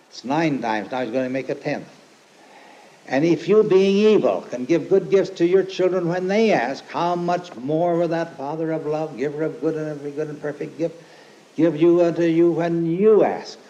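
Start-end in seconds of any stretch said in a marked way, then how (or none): 3.1-13.6 preaching climax. Acted. Climax of quoted scripture, tenth repetition.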